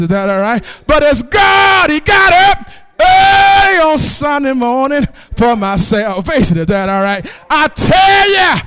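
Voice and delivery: rising shouted whoop on each "But as God", dropping back between; full-force preaching. Acted. Preacher whooping to the congregation about Jesus the winner. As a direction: preach it like a call and response, climbing to a roar.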